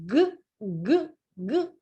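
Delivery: G said as a short hard "guh"; hard G sound. Shown as a demonstration. Teacher showing learners the other sound of G, short hard "guh". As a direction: pop G out as a brief "guh", each one separate. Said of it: "E pode ser G".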